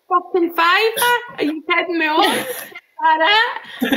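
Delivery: high, laughing mock outrage; mock indignant son. Psychic voicing the son mocking a small win, laughing. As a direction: play the son scoffing at the fiver, half laughing. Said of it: "And so he says".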